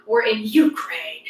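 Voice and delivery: slow, hammed-up war reporter; mock foreign correspondent. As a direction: draw it out like a self-important TV journalist. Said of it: "that's where they're like".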